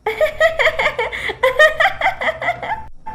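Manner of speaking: high giggling fit; child's loud laughter. Acted. Child laughing at the lisping bride. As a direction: laugh hard like a little boy. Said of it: "जोर जोर से हंसने लगा".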